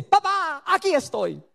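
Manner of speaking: high bright papá, then settling; a little girl popping out of hiding; child's reply. Retold or imitated. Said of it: "ella sale y dice".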